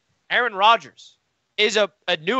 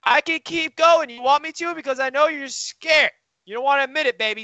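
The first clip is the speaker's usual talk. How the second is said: taunting; 9 semitones higher than usual. Real, not acted. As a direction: dare him to make you keep going. Taunting the rival cohost.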